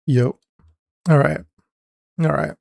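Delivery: normal talking voice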